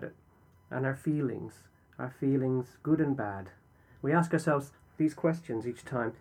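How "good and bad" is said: ordinary talk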